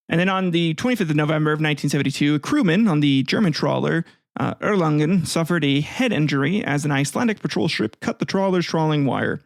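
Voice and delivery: ordinary talk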